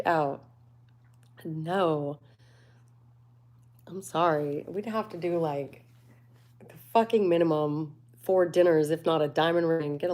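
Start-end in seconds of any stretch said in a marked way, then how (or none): none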